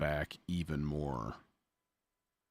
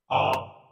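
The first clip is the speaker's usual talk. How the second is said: short burst of laughter; 7 semitones higher, 7 dB louder than usual. Real, not acted. Checking whether the patch worked.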